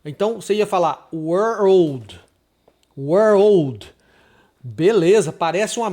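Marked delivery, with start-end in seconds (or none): 1.1-2.2 put-on "world" said as "were-old"; slow "world" made of "were" plus "old"; two-word mispronunciation. Shown as a demonstration. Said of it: "como se você pronunciasse duas palavras". 3.0-3.9 put-on "World" said as "were-old"; firm, louder demo of "World" as "were-old"; fuse "were" and "old" into "World"; two-word mispronunciation. Shown as a demonstration. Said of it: "como se você pronunciasse duas palavras".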